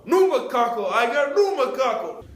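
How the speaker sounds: high, slow mocking voice; mocking the customer. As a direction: ham up the mangled word. Host mocks the customer's mispronunciation.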